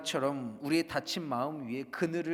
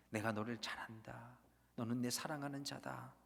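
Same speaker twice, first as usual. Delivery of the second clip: low, soft affirmation; warm loving voice; 5 semitones lower, 11 dB quieter than usual. Retold or imitated. God affirming his beloved child, soft and low. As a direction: speak it like a tender embrace. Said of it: "그 따뜻한 손길".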